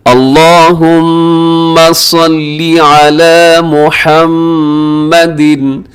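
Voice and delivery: measured tajweed recitation. Shown as a demonstration. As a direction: chant it slowly with long held vowels. Recites the durood.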